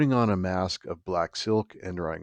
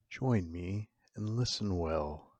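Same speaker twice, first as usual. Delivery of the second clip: ominous host intro; 4 dB quieter than usual. Acted. Horror host welcoming listeners to the Morbid Hour, hushed and ominous. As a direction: draw the listener into the dark.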